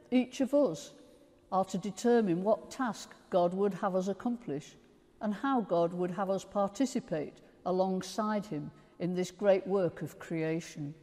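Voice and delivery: ordinary delivery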